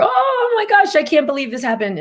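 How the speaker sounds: mock disbelief, rushing the words; overwhelmed friend. Imitating a friend thrown by every little thing, high shocked. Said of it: "immensely overwhelming".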